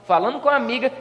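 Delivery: normal talking voice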